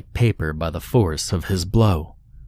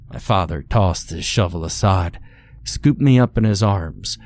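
ordinary delivery, nothing marked

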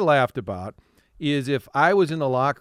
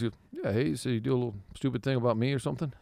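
soft, fast grumbling Tony; a hint of grouchy Tony; imitating Tony; 8 dB quieter, faster than usual. Tony confronts him on the phone, soft fast grumble. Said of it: "He goes".

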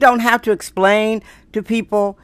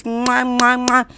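between kisses; 4 semitones higher, slower than usual. Real, not acted. Blowing kisses to listeners.

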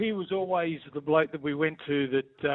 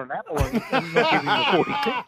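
laughing punchline; 4 semitones higher than usual. Real, not acted. Punchline of toll story.

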